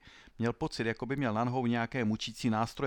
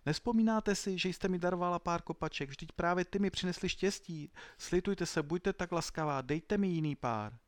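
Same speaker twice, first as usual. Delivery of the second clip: desperate pleading; 8 semitones higher than usual. Acted. Sandro begs the old woman for help: high desperate pleading. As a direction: beg her as if everything depends on it. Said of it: "úpěnlivě vás prosím".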